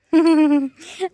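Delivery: a burst of open laughter; happy loud laughter. Real, not acted. Laughing with calves.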